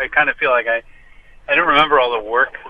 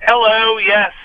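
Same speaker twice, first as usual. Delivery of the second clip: clipped phone line; 8 semitones higher, 4 dB louder, slower than usual. Real, not acted. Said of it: "You do sound like you're, you're calling from a car".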